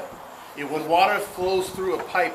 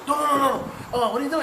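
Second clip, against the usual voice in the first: higher, indignant mock parent; hammed-up parent saying no no no; mock parent scolding; 7 semitones higher, much faster than usual. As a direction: play the shocked parent, higher up. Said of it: "And my kids".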